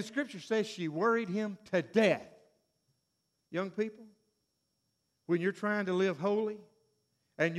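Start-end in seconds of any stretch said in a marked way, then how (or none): none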